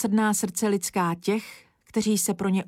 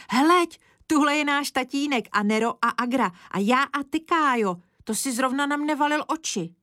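put-on high, eager girl pointing things out; excited calling; 6 semitones higher than usual. A narrator's reading. Girl showing Kája the third photo, excited calling. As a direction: burst in high, then list eagerly. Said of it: "volala Zdeňa".

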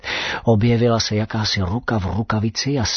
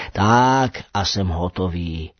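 slow satisfied; 6 semitones lower, slower than usual. Acted. Professor finishes work.

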